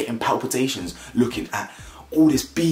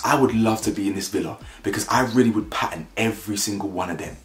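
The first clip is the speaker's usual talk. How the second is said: ordinary talk, nothing marked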